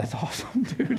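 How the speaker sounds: high, breathy laugh through the words; mock-guilty glee, laughing; amused delight. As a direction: let the delighted thought burst into laughter. Acts out delighted first thought.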